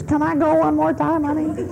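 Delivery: high, soft timid plea; pleading Charlie. Retold or imitated. Charlie softly begging his wife to let him go. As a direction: ask permission like a cowed husband. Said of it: "He says".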